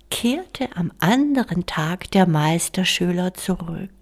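ordinary delivery, nothing marked

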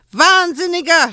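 sehr hoher, schriller Schrei. Acted. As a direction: schrei ihn entsetzt an. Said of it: "schrie sie Joel an". (Händlerin schreit Joel laut an)

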